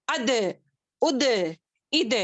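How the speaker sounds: اد said with an echoing bounce on the d; bouncing d. Shown as a demonstration. Teacher drilling the echo of the letter dal. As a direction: let the d bounce back. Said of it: "اس کو کیسے پڑھیں گے؟".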